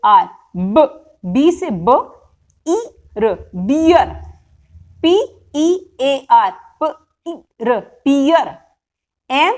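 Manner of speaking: slow, letter-by-letter spelling drill, each sound drawn out; phonics sound drill. Shown as a demonstration. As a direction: spell it out sound by sound for a class of kids. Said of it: "ये sound drill जो है, जोर से बच्चे को पढ़ानी है". (धीरे-धीरे अक्षर-अक्षर बोलकर साउंड ड्रिल)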